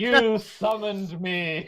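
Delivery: mock summoned demon. Answering as a summoned being.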